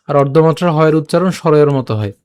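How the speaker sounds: usual speaking voice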